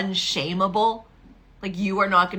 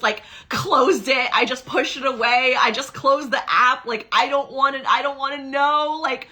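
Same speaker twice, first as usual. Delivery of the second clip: higher, louder, exasperated outburst; regretful woman; 4 semitones higher, 6 dB louder than usual. Retold or imitated. Coach voicing a future self full of regret, exasperated. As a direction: play the woman who let the year slip away. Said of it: "getting to the end of twenty twenty-six and going".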